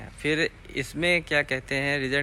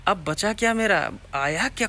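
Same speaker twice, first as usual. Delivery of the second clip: higher-pitched retold wondering; agitated inner question; 6 semitones higher, 3 dB louder than usual. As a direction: replay a sudden startled thought. Retells his sudden realisation about letter.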